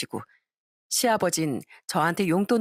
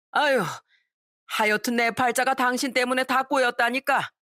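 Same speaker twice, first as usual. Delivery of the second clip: nagging rebuke; 4 semitones higher than usual. A narrator's reading. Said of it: "엄청 뭐라고 하시더라고요".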